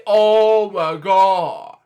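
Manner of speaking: very slow, stretched-out mimicry; imitated exclamation. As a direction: draw out every word, echoing his exclamation. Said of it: "That was his first time saying".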